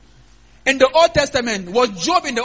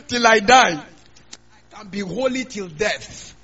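ordinary delivery

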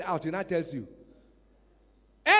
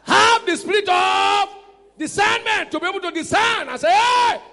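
preaching shout; 12 semitones higher, 11 dB louder than usual. Real, not acted. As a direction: drive it home at full force. Preaching climax.

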